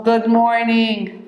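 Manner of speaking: usual speaking voice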